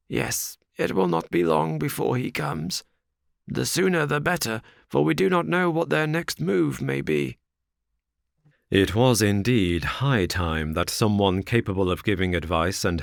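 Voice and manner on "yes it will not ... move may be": higher, uneasy reply; worried old father. Acted. Ferrier to Lucy, a higher uneasy voice. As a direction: voice his worry.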